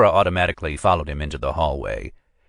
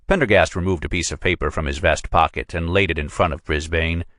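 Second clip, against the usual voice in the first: usual speaking voice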